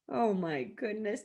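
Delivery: ordinary delivery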